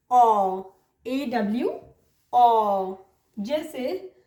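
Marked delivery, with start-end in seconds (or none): 0.1-2.9 "ऑ" low and drawn out as aw, no r; long AW sound. Shown as a demonstration. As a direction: say OR as a pure long "ऑ". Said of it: "उसको हम बोलते हैं".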